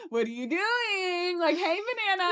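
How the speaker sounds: goof around in a high, stretched voice; goofy. Retold or imitated. Answering the bestie's FaceTime call, goofy. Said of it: "being goofy as hell".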